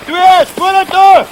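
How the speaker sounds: loud, slow drill command; drawn-out field command, loud; shouted order. Real, not acted. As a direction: bellow it with each word stretched.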